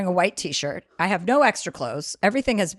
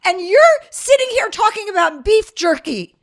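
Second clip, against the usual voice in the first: loud, high, laughing outrage; yelling at the cousin who declared beef jerky, furious and laughing; exasperated shout; 10 semitones higher, 9 dB louder than usual. Retold or imitated. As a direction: blow up at the cousin in disbelief. Ranting at cousin about jerky.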